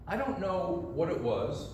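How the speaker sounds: ordinary delivery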